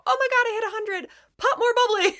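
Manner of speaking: high-pitched, quick burst of delight; thrilled celebration. Retold or imitated. Retelling her hitting a hundred over champagne, thrilled. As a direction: re-enact a giddy victory cheer.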